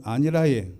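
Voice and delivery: ordinary delivery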